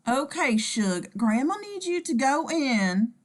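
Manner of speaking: slow, coaxing southern nana; coaxing grandma. Acted. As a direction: coax him gently, slowly.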